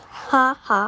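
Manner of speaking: ordinary delivery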